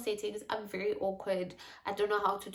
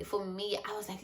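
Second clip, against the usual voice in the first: ordinary delivery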